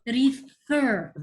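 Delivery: refer split, FER long and strong; stress on second syllable. Shown as a demonstration. Teacher repeating refer for a pupil, second syllable stressed. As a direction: lean hard on the end of refer. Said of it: "What do you notice about the second syllable".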